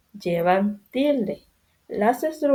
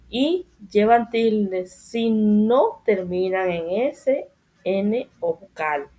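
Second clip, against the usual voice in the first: dictando despacio; slower than usual. Shown as a demonstration. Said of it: "maestra dicta la regla".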